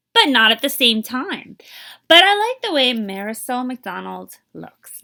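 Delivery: lively, expressive picture-book reading; teacher reading a storybook to young kids; reading to children. Acted. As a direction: read it aloud to a class, bringing each line to life. Teacher reads picture book aloud.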